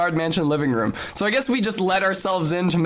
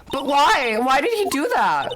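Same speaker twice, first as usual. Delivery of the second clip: put-on high upset girl voice; 11 semitones higher than usual. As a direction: cry out high and distressed.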